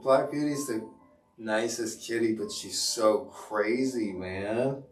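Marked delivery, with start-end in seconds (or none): none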